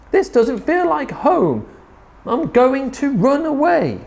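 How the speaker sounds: high, plaintive little voice; crying out. A narrator's reading. Drip decides to leave home, high plaintive cry. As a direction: complain in a high wail. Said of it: "And he cried".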